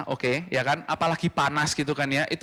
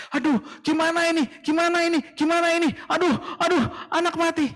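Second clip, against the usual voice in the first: high, anxious repeated worrying; high-pitched frantic worry; panicked fretting; 14 semitones higher than usual. Acted. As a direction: fret in rising panic. Panicked Egyptian neighbours.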